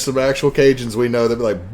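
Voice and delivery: ordinary delivery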